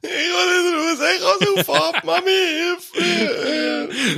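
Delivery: very high, crying Scottish-free panic plea, mock drowning; panicked crying. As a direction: wail for help like a child in the water. Mimicking panicking swimmers in water. (gespielt panisches, hohes Weinen um Hilfe)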